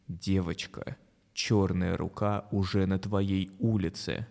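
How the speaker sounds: low, slow menacing voice; creepy broadcast voice, drawn out; scary voice. Acted. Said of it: "страшный голос говорит".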